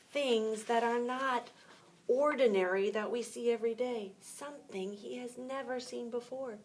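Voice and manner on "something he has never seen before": ordinary delivery, nothing marked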